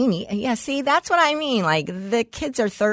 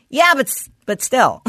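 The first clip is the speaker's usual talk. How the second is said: lame comeback; 4 semitones higher, 4 dB louder than usual. Retold or imitated. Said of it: "you gotta go".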